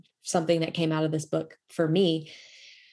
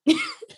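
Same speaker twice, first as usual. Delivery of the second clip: laugh; 3 dB louder than usual. Real, not acted. Laughing at her own resolve.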